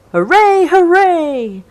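high, loud joyful hooray; cheering. A narrator's reading. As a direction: cheer like happy sailors. Sailors cheer the party.

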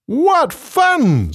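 high, delighted hippo. Acted. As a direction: squeal it like a happy hippo. Hippo speaks in the story.

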